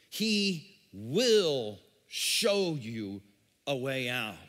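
very slow, weighty, word by word; slow emphatic repetition. Real, not acted. Verse repeated slowly for emphasis.

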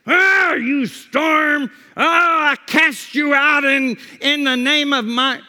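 hammed-up shout, high and loud; mock bombastic command. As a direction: bellow theatrically as if casting out a storm. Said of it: "he doesn't get up and say".

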